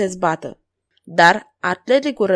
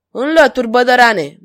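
usual speaking voice

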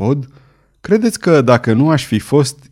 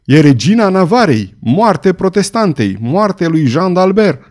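loud, higher, slowing battle cry; stentorian shout; 6 semitones higher, 3 dB louder than usual. A narrator's reading. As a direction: roar over the crowd noise. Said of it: "a cărui voce de stentor acoperi strigătele".

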